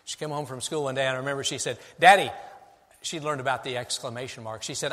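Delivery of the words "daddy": put-on a child's bright call of Daddy, louder; excited little girl calling Daddy.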